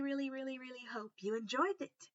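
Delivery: usual speaking voice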